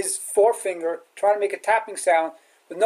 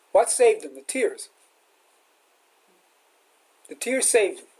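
normal talking voice

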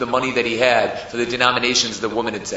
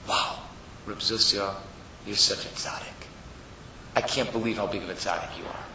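soft, low murmur of awe; hushed flattery; 4 semitones lower, 10 dB quieter than usual. Acted. Yetzer Hara tempting Zusha with praise, softly. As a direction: flatter quietly, like an inner voice.

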